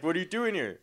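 imitating a bullying stranger; mock confrontation. Army guy confronting a young man in his neighborhood.

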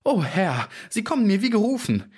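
very high, relieved little-man cry; overjoyed small fellow calling out; eager exclamation. A narrator's reading. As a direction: greet a rescuer with fervour, pitch soaring. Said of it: "rief der kleine Bursche".

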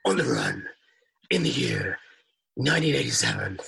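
husky superhero voice played for laughs; Batman. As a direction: deliver it in short, separate phrases as a mock dark vigilante. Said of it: "A Batman voice".

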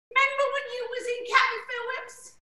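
put-on teasing a young pop star's chatter. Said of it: "he kept saying to [name]".

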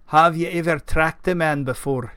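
burly Scottish knight's gruff voice; gruff Scotsman. A narrator's reading. Gawain asks Keith about tracking, gruff Scottish voice. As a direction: play a gruff Scotsman sounding out his companion. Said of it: "gruff voice that matched his exterior".

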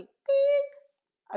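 sharp high camera click sound. Shown as a demonstration. Teacher imitating a camera shutter for her audience, high click. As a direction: snap it out like a shutter. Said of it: "me saque una foto así".

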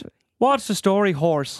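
put-on Irish lilt dropping at the end; Irish accent. Shown as a demonstration. Co-host's last quiz accent, Irish accent. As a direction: try an Irish accent on the greeting. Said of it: "Irish, Irish".

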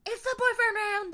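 high, loud game-show holler; mock show announcement. Host renames the quiz round for partner, loud high holler. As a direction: shout the new round title.

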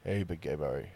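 very low, soft, sultry purr; phone sex voice. Pretend. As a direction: breathe it low to seduce. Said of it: "this is my phone sex voice".

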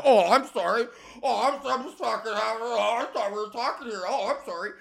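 high-pitched, affronted fake apology; sarcastic wounded "oh, sorry" act; mock offended. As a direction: mimic a touchy drunk taking offence, voice way up. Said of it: "that sort of fucking attitude".

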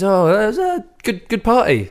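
high, bright put-on voice; mock friendly compliment. Friend praising her party in a high, hammed-up voice. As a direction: ham up trying to be nice.

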